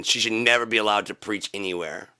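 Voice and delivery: usual speaking voice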